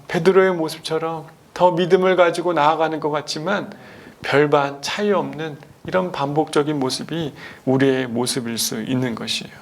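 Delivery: ordinary delivery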